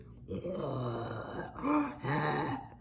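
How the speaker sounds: a strained breathy gasp standing in for the demon noise; ragged gasping cry. Retold or imitated. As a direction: gasp it out like a monster breathing in the dark. Imitating the demon noise heard.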